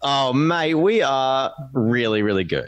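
drawn-out Australian "really, really good". Real, not acted. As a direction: lay on the Australian accent. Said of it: "Could he sound more Australian than that?".

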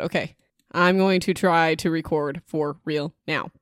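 ordinary talk, nothing marked

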